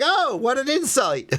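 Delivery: mock your own big revelation in a high voice; mock amazement. Streamer laughing at his own obvious discovery, mock amazement.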